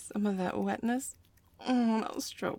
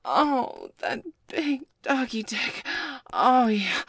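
moaning; 6 semitones higher, 6 dB louder, much slower than usual. Acted. Moaning while being mounted.

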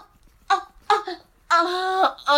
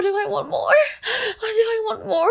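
ordinary delivery

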